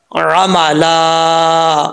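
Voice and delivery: अमला very slow, last aa held long; long final alif. Shown as a demonstration. Teacher showing the stop on alif, long drawn vowel. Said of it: "अलिफ पढ़ा जाएगा".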